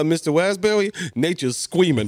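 "Raspberry" and "squeaming" with R turned to w or l, laughing; "squeaming" for screaming, like a small kid; says w for r. Retold or imitated. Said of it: "I couldn't say my R's".

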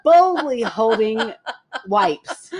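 high, giggly storytelling through laughter; can barely get it out for laughing; laughing while telling. Real, not acted. Telling her friend the gas-station story, laughing throughout.

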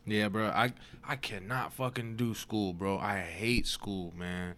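ordinary talk

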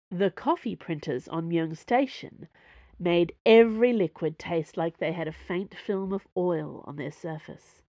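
usual speaking voice

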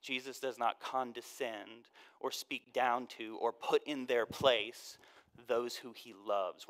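usual speaking voice

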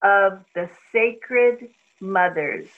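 intoned slowly, word by word; unhurried chanted mantra. Acted. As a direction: lay out each word for her to repeat. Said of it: "I'll say it very slowly".

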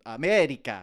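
sing-shout it like West Side Story; Rita Moreno impression. Comparing Fabrizio to Rita Moreno, mock Latin accent. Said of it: "sounds like Rita Moreno in West Side Story".

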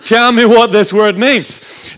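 slow, loud, word-by-word demand; mock-threatening demand. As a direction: spell out the ultimatum with mock menace.

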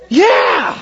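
a sudden happy yell; cheering shout. Real, not acted. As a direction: cheer out loud. Teller cheers mid-story.

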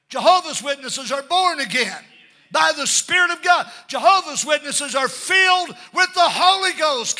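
high, ringing proclamation; emphatic preaching shout. Real, not acted. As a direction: declare it forcefully to the church. Preacher declares true witnesses with force.

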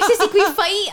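high, loud mock little-kid voice; imitated kid brother, laughing through it; begging little brother. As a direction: beg eagerly like a small child.

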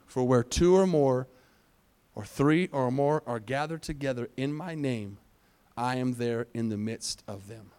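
hushed, low Bible verse delivery; quiet, deliberate pulpit reading; scripture reading. Acted. Reads scripture aloud.

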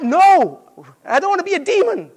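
high, loud mock horror; mock protest. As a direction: recoil at the very idea, hammed-up. Mock horrified denial.

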